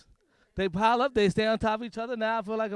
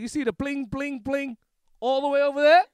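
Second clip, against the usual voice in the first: Telemundo reporter; 6 semitones higher, 8 dB louder than usual. Pretend. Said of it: "He was like".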